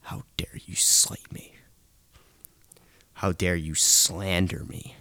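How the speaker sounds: ordinary talk, nothing marked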